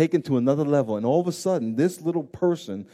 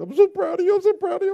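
much higher gushing mother voice; doting mother; 6 dB louder, faster than usual. Retold or imitated. Mother hugging her grown son, gushing proudly in a high voice. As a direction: gush like a proud little mom squeezing her son.

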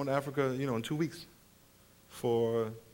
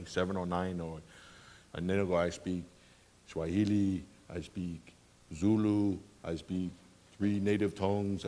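offhand multilingual bellman; 12 semitones lower, 10 dB quieter than usual. Retold or imitated. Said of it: "They go".

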